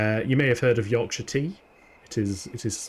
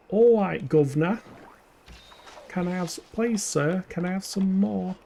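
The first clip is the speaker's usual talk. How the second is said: higher-pitched mock Cockney; London accent; 7 semitones higher than usual. Shown as a demonstration. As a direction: imitate a pleading London street urchin, pitched up. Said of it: "your London accents".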